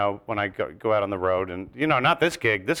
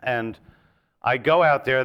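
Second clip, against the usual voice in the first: normal talking voice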